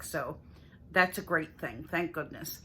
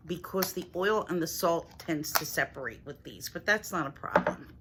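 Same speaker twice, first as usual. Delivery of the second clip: usual speaking voice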